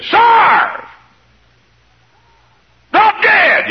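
high furious shout of revenge; angry shout. Pretend. Preacher voicing a vengeful man: furious shout. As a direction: yell it like a wronged man wanting payback.

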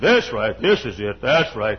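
deep, emphatic preacher's voice; low voice, hit the first word hard; Lee Robertson. Retold or imitated. Imitating an admired older preacher at the pulpit, deep and emphatic. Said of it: "And his voice, deep".

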